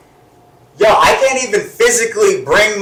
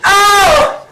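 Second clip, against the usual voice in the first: shocked; 12 semitones higher, 4 dB louder than usual. Real, not acted. Reacting to twerking story.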